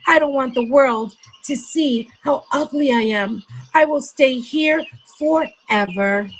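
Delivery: put-on a hurt child hiding from the world; sulky duckling. Duckling refuses to leave cave.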